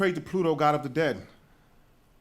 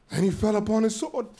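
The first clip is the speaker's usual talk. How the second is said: ordinary talk, nothing marked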